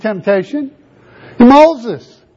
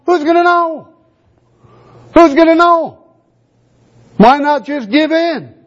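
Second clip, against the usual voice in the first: higher, coaxing voice of temptation; tempting voice; 4 semitones higher than usual. Acted. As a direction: slip it in like a whisper of temptation. Temptation voiced in Joseph story.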